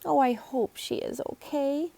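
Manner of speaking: put-on a fretting older shopkeeper, slowed down; shop owner voice.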